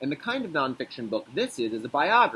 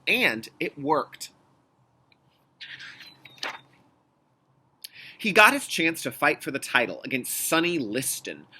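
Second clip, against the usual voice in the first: put-on reading to children. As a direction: read it like a picture book for kids. Reads the picture book aloud.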